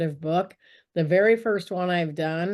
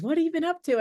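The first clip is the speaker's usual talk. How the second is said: a higher, light imitation of a friend; friend's curious question; 10 semitones higher, much faster than usual. Friend asks after meeting.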